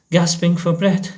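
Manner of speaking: plain reading voice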